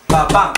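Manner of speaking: light first "ba", punched second "ba"; second-syllable stress. Shown as a demonstration. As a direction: hit the second "ba" of "ba, ba". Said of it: "emphasis on the second syllable".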